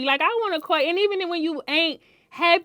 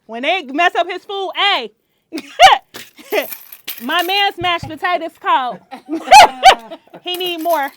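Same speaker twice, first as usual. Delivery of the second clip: laughing; 5 semitones higher, 8 dB louder than usual. Real, not acted. Laughing about husband's food.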